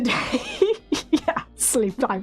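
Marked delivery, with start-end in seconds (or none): 0.5-1.1 high giggle. Real, not acted. Laughing at being tired.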